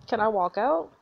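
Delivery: usual speaking voice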